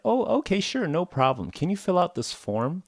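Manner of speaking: high, accommodating receptionist voice; obliging staff. Retold or imitated. Receptionist hands a new patient a form, high obliging voice. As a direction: put on a high, helpful clerk. Voicing helpful front desk staff.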